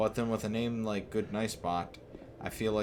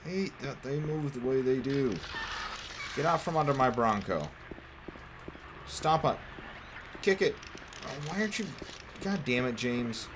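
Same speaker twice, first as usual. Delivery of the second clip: frustrated yelling; 6 semitones higher than usual. Real, not acted. Streamer screaming at monsters under his car, furious. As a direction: bark commands in real rage.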